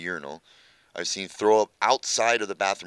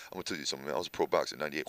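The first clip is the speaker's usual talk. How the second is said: softer, lower streetwise voice; lowered Reg imitation; 4 semitones lower, 7 dB quieter, much faster than usual. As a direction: lean in and lower it like a confidential brag. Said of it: "That's how he talks".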